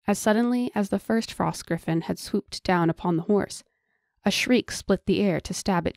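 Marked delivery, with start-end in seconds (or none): none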